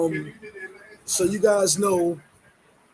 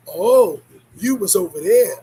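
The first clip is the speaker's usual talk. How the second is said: accusing; 2 semitones lower, 8 dB louder, slower than usual. Retold or imitated. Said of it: "And you be like".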